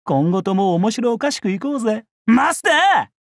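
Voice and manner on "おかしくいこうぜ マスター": high-pitched enthusiastic call; rousing shout. Acted. Warrior cheering on his master, rousing shout.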